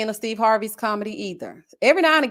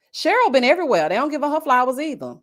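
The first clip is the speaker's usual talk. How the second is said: ordinary talk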